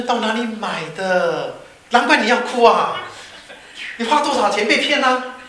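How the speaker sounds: loud, high-pitched teasing scorn; mock incredulity. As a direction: play the appalled friend ribbing him. Friend mocks the badly knitted scarf.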